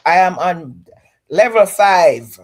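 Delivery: playful loud boasting; excited emphatic. Real, not acted. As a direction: hype it up, loud and proud. Joking about being on fence.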